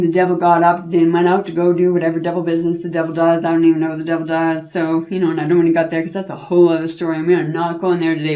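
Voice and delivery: very fast tossed-off aside; rushed aside. Real, not acted. Storyteller hurrying through an aside, fast. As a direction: rattle it off.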